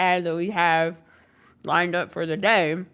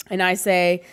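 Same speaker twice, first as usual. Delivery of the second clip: normal talking voice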